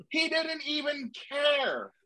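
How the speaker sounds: high, slow, drawn-out cry; drawn-out exasperated cry. Real, not acted. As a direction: stretch out each word in disbelief.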